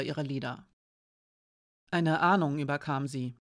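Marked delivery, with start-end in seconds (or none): none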